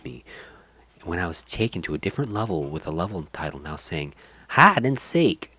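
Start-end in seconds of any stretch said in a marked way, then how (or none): 4.5-5.5 slow, high, loud creepy doll voice; too calm creepy doll. Acted. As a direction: drag it out like a haunted doll. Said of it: "I wanna do that voice".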